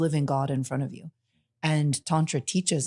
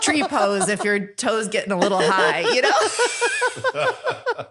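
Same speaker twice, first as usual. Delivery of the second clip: louder and climbing higher into laughter; laughing; 6 semitones higher, 4 dB louder, much slower than usual. Real, not acted. Guest joking about yoga poses, laughing. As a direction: joke it while cracking up.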